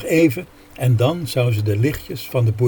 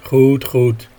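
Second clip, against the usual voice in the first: louder, gruff giving in; grumbling; 5 dB louder than usual. A narrator's reading. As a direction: grudgingly agree with a growl. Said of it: "gromde Gerrit".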